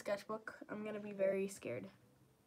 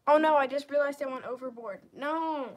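dismayed; 3 semitones higher, 13 dB louder than usual. Real, not acted. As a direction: blurt out a sudden mistake. Realizes she overdid drawing.

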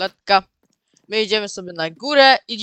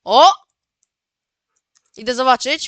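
usual speaking voice

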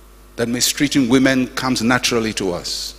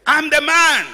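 high, slow, hammed-up indignation; complaining husband protesting to the pastor, high and slow; mock indignant husband; 13 semitones higher, 4 dB louder, slower than usual. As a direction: ham up the offended hungry husband. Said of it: "He said".

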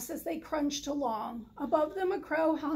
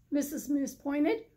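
ordinary delivery, nothing marked

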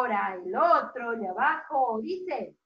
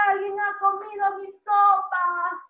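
higher-pitched play-acted complaint; little bear; 4 semitones higher, 4 dB louder than usual. Teacher voices osito finding his soup eaten, high complaint. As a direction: wail it out like an upset cub. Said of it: "Y el osito dijo".

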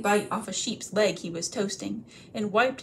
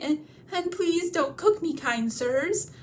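much higher, begging voice; pleading hobbit; 6 semitones higher than usual. Acted. Bilbo begging the trolls not to cook him, high and pleading. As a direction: beg for your life.

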